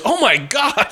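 high-pitched astonished gasp of words; acted shock. Imitating husband seeing the punch, shocked. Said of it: "Matt McCoy goes".

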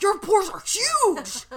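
loud, high, drawn-out mock cry; exaggerated high-pitched shout, slowly stretched; blunt exclamation. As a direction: imitate her blurting it out right in your face. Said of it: "got right next to my nose and was like".

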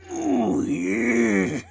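strained, red-in-the-face wrestler growl; Macho Man. Shown as a demonstration. Friend showing pals how it's done, Macho Man impression. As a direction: push it out low, as if straining hard. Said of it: "do a bit of Macho Man".

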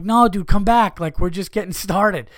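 loud, high, pleading call; calling someone back. Retold or imitated. Mock party host begging guest back, loudly pleading. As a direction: holler after a departing friend.

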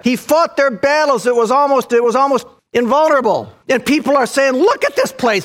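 high, loud, fervent preaching; preaching at full force. Acted. Preacher building to a peak.

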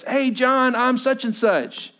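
acted-out friendly hail, pitched high; hearty greeting. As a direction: greet an old acquaintance brightly, drawing out the end. Acquaintance greets dad, acted out.

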